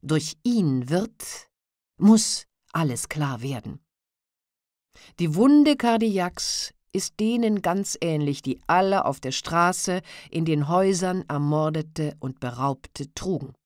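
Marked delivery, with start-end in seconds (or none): none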